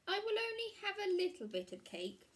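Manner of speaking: put-on high, light girlish voice; little girl Red. A narrator's reading. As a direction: voice Red as a small child talking herself into it. Red tempted by the cake.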